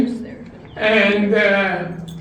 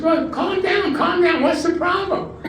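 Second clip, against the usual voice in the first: pitched up high, soothing the patient in mock calm; high mock calming doctor; 8 semitones higher than usual. Doctor calming the excited husband, high mock soothing. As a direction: play the doctor settling a frantic man, voice lifted high.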